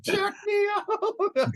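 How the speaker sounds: mock the fighter yelling with glee; imitating an overexcited fighter beside Bruce Buffer; starstruck fighter. Starstruck fighter next to announcer.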